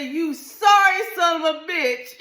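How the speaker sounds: high, loud yell; yelled insult. Retold or imitated. Wife yells at husband over birth control, high and loud. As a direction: yell it right at him. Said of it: "I yelled it out".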